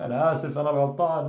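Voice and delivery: mock-tearful, pleading apology; put-on remorse, slightly whiny plea; emotional apology. Shown as a demonstration. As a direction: act sorry, almost on the edge of crying. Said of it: "but don't cry, yani".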